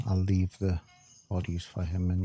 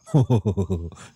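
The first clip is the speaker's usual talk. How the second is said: loud laugh; 7 dB louder than usual. Real, not acted. Laughing at the mishap.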